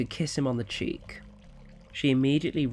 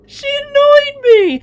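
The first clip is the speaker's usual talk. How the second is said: a slow, wailing protest, much higher and louder; crying child; 16 dB louder, slower than usual. Acted. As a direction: sob it out in defiance. Crying boy defends himself.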